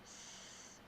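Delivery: S hissed alone, unvoiced; isolated S sound. Shown as a demonstration. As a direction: hiss the S sound by itself. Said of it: "tap out all the sounds I hear".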